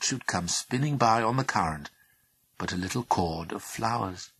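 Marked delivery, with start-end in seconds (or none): none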